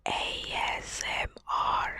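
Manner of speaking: slow, breathy ASMR whisper into the mic. Shown as a demonstration. Testing her new mic for viewers, a slow ASMR whisper. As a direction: whisper it softly like an ASMR video. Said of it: "Coba ya".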